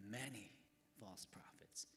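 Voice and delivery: emphasis on many, then near whisper; stress on many. Shown as a demonstration. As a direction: lean on many, then drop the voice. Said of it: "Jesus didn't say some".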